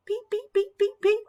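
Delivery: high little chick peeps; duckling peeps. Acted. Ducklings peeping in their sleep, high and tiny.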